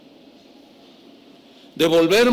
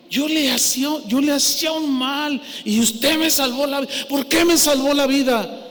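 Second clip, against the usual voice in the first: amazed plea; 7 semitones higher than usual. Retold or imitated. Neighbour's amazed questioning.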